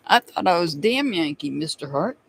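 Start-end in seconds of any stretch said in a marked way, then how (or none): none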